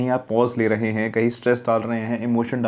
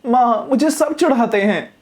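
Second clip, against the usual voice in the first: high, slow, troubled whine; upset child complaining; 10 semitones higher than usual. Shown as a demonstration. Teacher shows how an upset child tells her mother she is teased, high and slow. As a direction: sound genuinely bothered. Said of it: "आपकी आवाज़ में वो होना चाहिए".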